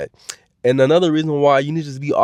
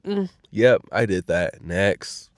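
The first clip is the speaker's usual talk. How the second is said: cool understated win; 5 semitones lower, 4 dB quieter, slower than usual. Retold or imitated. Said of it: "and was like".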